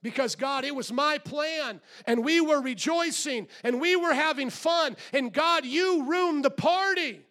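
David voiced high and loud, ranting at God; angry complaint. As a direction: wail it at God like a spoiled child. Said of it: "he said".

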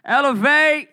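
high, loud call-out; rallying shout. Real, not acted. Youth director hailing the youth group, rallying shout. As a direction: shout it to fire up the room.

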